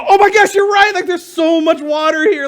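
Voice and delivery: high, loud, gushing alarm; mock panic. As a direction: play a flustered overreaction. Mock panicked reply Moses doesn't give.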